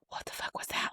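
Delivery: hushed, voiceless whisper; alarmed whisper. Retold or imitated. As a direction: whisper it to your partner in the dark. Couple react secretly in dark room.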